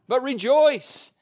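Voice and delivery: high, loud, slow "rejoice"; emphatic rejoice. Acted. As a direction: stretch and lift "rejoice" like a call. Climax of scripture reading.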